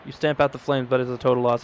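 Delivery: ordinary delivery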